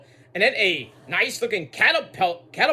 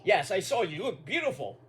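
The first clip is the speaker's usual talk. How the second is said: soft proud dad; 6 dB quieter than usual. Pretend.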